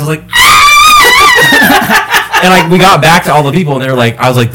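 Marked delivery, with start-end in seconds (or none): 0.2-2.4 long, very high shriek; reenacted scream. Rider with eyes closed screaming on a wild ride, reenacted. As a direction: let out a drawn-out piercing mock scream. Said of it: "Did you guys hear me screaming?".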